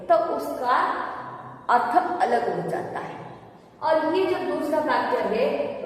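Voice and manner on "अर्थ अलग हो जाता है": ordinary talk, nothing marked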